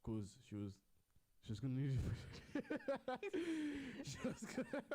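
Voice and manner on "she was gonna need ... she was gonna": high giggling laugh breaking up the words; words squeezed out between bursts of high laughter. Real, not acted. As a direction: giggle through it, can't get the line out. Laughing mid-retelling, loses it.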